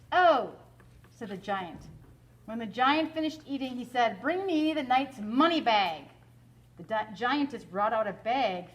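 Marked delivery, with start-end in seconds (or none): 0.1-0.5 loud, higher giant grunt; a dim giant, loudly; booming giant oh. Acted. Giant's reply. 4.2-6.0 giant's command. Acted.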